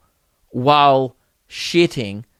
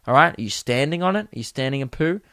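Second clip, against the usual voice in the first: ordinary talk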